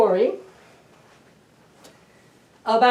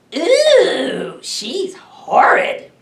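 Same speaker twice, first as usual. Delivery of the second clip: delighted gasp; 5 semitones higher, 6 dB louder, much slower than usual. Acted. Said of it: "gasped Mister and Missus Monster happily".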